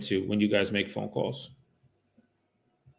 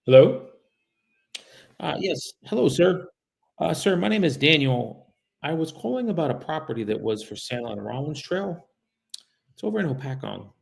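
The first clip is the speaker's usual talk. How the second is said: bright, higher courteous caller voice; polite phone sales voice; 6 semitones higher than usual. Acted. Caller greeting a stranger on the phone, polite and raised. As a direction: sound friendly and eager to the seller.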